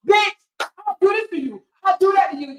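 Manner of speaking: high, loud mocking threat. Mocking Steph's online threats, loud and high. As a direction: ham up a big tough-talk threat. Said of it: "And be like".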